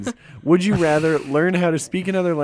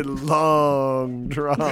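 slow emphasis. Acted. Stressing the gross phrase.

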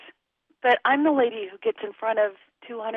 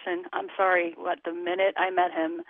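ordinary talk

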